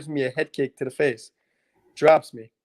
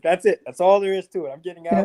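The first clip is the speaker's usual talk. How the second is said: louder and higher, a retold self-pep yell; fierce resolve; 4 semitones higher, 6 dB louder than usual. Fighter retelling escaping a choke, in a mock determined yell. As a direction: spur yourself on, half-shouting.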